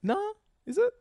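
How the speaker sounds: high, disbelieving question; surprised. Real, not acted. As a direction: shoot up in surprise. Surprised when his claim is contradicted.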